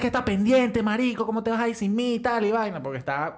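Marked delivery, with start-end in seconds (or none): 0.0-0.8 high, worked-up retold telling-off; mock scolding. Man left behind scolds his friend, mock angry rant. 0.8-2.8 high, worked-up retold telling-off; mock scolding. Man left behind scolds his friend, mock angry rant.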